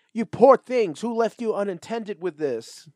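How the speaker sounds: put-on high, loud, fussy scolding old lady; nosy old woman. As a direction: fuss over the kids like a busybody. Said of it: "and is like".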